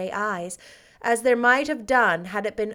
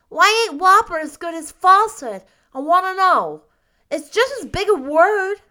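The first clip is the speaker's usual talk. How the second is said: put-on high, loud, insistent child; inquisitive little boy Davy; 10 semitones higher, 10 dB louder than usual. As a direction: argue like a curious small boy. Davy questions the word.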